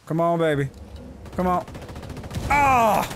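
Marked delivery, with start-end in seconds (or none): 2.5-3.1 effort yell. Real, not acted. Grenade throw in firefight.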